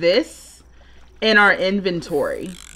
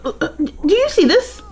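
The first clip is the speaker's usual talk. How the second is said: much higher, incredulous squeals with laughter breaking in; shocked disbelief; 13 semitones higher than usual. Real, not acted. Player gasping at a child running her sim's club, squealing in disbelief. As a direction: pitch way up in stunned disbelief at the game.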